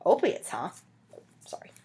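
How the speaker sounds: ordinary delivery, nothing marked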